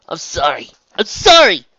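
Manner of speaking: loud, high cry of apology; frantic apology. A narrator's reading. Raindrops apologises as she flees, crying out frantically. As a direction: cry it out, climbing to a yell.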